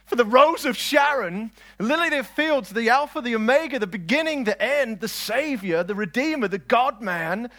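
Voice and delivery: high, loud roll call of titles; impassioned preaching. Acted. Preacher exalting Christ to the congregation, impassioned. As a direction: proclaim each title with ringing force.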